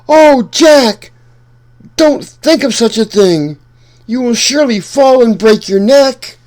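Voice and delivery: high, loud, panicked mother's cry; frightened cry. Acted. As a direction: cry out in fear for her son. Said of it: "she ran outside and cried".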